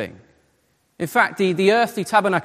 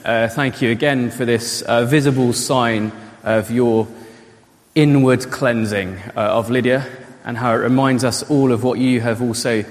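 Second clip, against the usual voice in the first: low-pitched, earnest prayer voice; public prayer; 5 semitones lower than usual. Real, not acted. Pastor leads the church in thanks to God, prayerful. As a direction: pray aloud with measured pauses.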